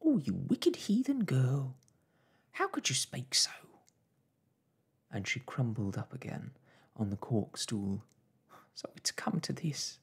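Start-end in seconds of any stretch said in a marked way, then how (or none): 0.0-3.5 high, loud motherly reproach; shocked scolding. Acted. Mother reproaches daughter's wicked talk, shocked. 8.7-10.0 higher, crushed mother's voice; despairing mother. Acted. Mother crumples onto stool, despairing.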